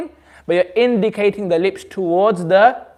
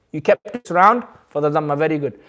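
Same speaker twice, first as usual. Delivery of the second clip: ordinary talk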